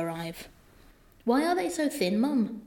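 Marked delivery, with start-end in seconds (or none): 1.2-2.6 put-on higher-pitched West Country child; lightly acted child's voice, pitched up; child mud person. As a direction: read as a curious country child asking Mum. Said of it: "child mud person talking to her mum".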